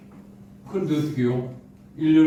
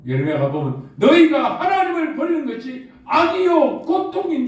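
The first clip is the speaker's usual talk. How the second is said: higher, louder sermon voice; fervent preaching; 10 semitones higher, 4 dB louder than usual. Acted. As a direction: thunder the verse to the church. Preaching on evil. (뜨겁게 외치는 설교)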